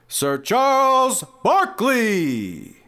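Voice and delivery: loud, high, drawn-out hype introduction; announcer shout. Pretend. Host introducing Charles Barkley to listeners, long announcer shout. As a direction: belt out the name like an arena announcer.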